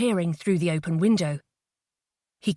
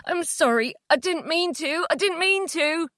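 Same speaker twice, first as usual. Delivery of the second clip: tearful apology; 8 semitones higher than usual. A narrator's reading. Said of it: "his eyes filling with tears".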